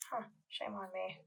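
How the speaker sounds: talking around a paintbrush; words muffled by a brush held in the mouth; paintbrush in teeth. Real, not acted. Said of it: "Paintbrush is in my teeth if I'm talking funny".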